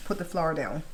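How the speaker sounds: ordinary delivery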